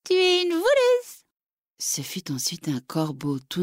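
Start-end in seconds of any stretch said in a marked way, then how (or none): 0.0-1.2 very high, chirpy little bird voice; high twittering songbird accusing. Acted. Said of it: "en lui gazouillant".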